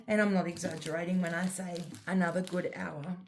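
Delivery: ordinary talk, nothing marked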